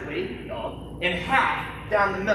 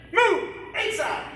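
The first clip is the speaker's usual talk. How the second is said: drill command shout; 9 semitones higher, much slower than usual. Real, not acted. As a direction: snap the command out to the class. Instructor calling drill commands.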